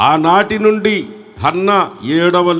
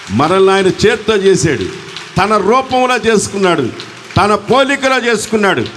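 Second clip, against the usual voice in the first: impassioned preaching; 6 semitones higher than usual. Real, not acted. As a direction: pitch climbing phrase by phrase to a high peak.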